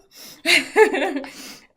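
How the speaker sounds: loud burst of laughter; big real laugh. Real, not acted. Laughs at own funky face.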